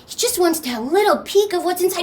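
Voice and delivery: ordinary delivery, nothing marked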